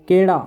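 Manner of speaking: केड़ा said with a flapped ड़ where केला has l; केड़ा, loud, ड़ flapped; demo pronunciation. Shown as a demonstration. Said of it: "विशिष्ट ध्वनि अगर हम निकालेंगे तो केड़ा".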